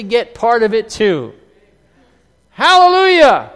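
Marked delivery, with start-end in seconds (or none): 2.5-3.5 high, loud hallelujah cry; joyful shout. Real, not acted. Preacher shouting praise to the congregation.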